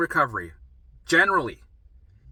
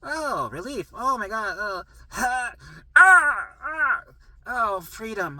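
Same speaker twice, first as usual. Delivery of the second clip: high, gushing hammed-up relief ending in a cry; over-the-top relieved squeal; mock relief; 8 semitones higher, 6 dB louder, much slower than usual. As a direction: sigh out relief with exaggerated joy. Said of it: "That's what it's like".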